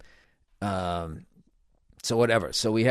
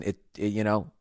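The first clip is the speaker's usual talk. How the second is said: ordinary talk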